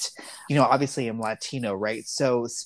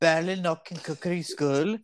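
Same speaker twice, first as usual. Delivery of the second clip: attempted Irish accent; 3 semitones higher than usual. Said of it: "You have to say it in a sing-songy kind of way".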